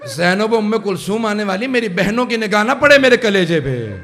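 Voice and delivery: rising lament. Acted.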